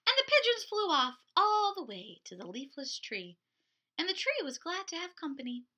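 put-on lively picture-book narration for little kids; storytime reading. A narrator's reading. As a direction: read it like a children's librarian. Picture-book read aloud to kids.

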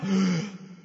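sharp gasping inhale; a dead man's first gasp; revival gasp. Acted. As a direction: gasp as breath returns. Lazarus breathes back to life.

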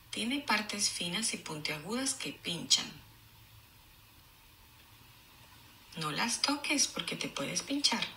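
clear, even read-aloud; storybook reading voice; reading to children. Acted. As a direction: read the page to the class. Teacher reads book aloud.

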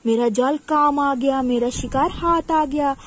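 loud, triumphant villain crowing; hammed-up robber's boast; gloating bandit. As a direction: crow loudly over the trapped prey. Robber gloats over his catch.

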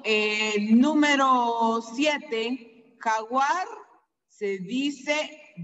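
ordinary talk, nothing marked

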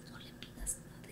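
hushed aside, voice almost gone; whispered warning. Retold or imitated. Mother warns daughter not to ask Raúl, whispered. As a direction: breathe it so Raúl can't hear.

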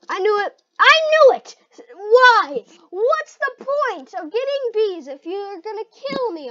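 high, very loud protest; outraged gamer shout. Real, not acted. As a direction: yell in exasperation at being betrayed. Outraged at being killed in game.

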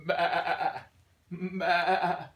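wavering playful bleat; sheep bleat. Pretend. Sheep sound for a child.

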